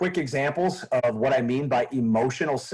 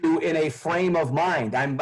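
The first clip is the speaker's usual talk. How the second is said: ordinary talk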